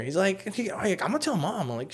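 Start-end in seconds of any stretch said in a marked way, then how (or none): 1.0-1.7 high, softer sing-out threat; tattletale brother, imitated. Brother threatens to rat him out, tattletale imitation. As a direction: mimic a snitch.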